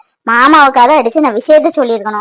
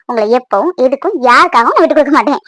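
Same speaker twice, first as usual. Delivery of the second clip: ordinary talk, nothing marked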